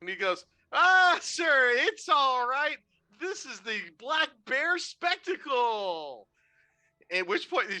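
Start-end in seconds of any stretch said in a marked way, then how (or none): 0.7-6.3 high, slow, loud circus patter; showman clown. Acted. Clown covering for the bear as a show. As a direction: sell the mishap like part of the act. Clown voice smoothing things over.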